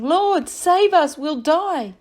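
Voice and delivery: slow, high, loud cry for rescue; frightened disciples pleading with their Lord; desperate plea. Acted. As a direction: beg for your life. Disciples begging Jesus in storm.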